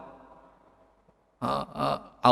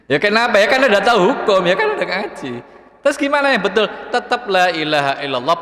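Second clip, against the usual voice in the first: higher and louder, quick; animated emphasis; 5 semitones higher, 4 dB louder, faster than usual. Real, not acted. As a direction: fire off the questions. Preacher rhetorical emphasis.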